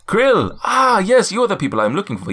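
high, loud put-on African-ish accent; hammed-up royal welcome, pitched high; Coming to America accent. GM voicing the cloud giant greeting the adventurers, put-on accent. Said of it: "an Eddie Murphy sort of Coming to America sort of vibe".

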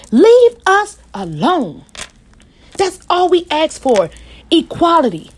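rising, raw appeal; impassioned plea. Real, not acted. Impassioned plea to those who fear blacks.

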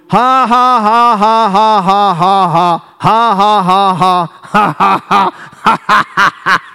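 long forced ha-ha-ha in rolling bursts; defiant laugh at problems. Shown as a demonstration. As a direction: laugh on and on in the face of trouble. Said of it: "look at your problem and go".

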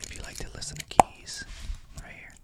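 fast breathy ASMR whisper. Pretend. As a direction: lean in and whisper about keys. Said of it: "You gotta whisper the whole time".

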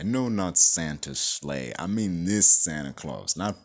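usual speaking voice

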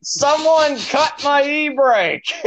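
high, slow, drawn-out yell out the truck window; retold scream. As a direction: yell it like a drunk panicking from his truck.

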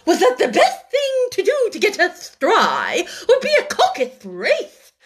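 high, loud, grand announcement; offended Dodo. A narrator's reading. The offended Dodo proposes the race, grand. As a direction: announce it with injured pride. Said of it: "in an offended tone".

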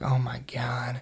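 near-whispered, soft reaction; hushed dismay. Retold or imitated. Hearing his friend's bad news, hushed dismay. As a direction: let it out under your breath, appalled.

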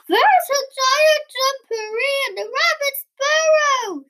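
high-pitched panicky wail; alarmed. A narrator's reading. Frightened Bear tells Elephant about the intruder, high alarmed wail. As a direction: cry it out high as a scared bear.

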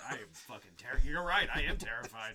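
loud, strained mock-terrified voice; grating voice. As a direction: yell it like a scared sidekick. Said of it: "Stop talking in that voice".

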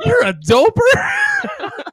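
very high, loud, stretched-out incredulity; mock surprise. Friend disowning Steve in front of mom, hammed-up surprise. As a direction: squeal the question slowly in fake shock. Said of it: "acts so surprised".

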